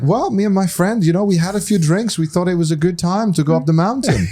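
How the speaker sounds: breezy explanation, voice lifted; imitating Mario. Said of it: "He's like".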